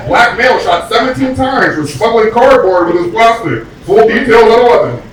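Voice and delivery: hammed-up TV news report; mock news anchor. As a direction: read it like a late-night anchor.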